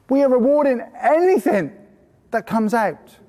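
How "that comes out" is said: usual speaking voice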